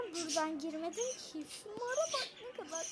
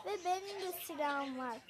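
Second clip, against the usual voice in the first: ordinary delivery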